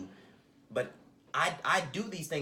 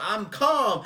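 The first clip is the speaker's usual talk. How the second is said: yelled much higher and louder, insisting he is calm; yelling; 8 semitones higher, 11 dB louder than usual. Retold or imitated. Man on a plane angrily yelling that he is calm. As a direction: shout the denial while losing your temper. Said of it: "now you're yelling".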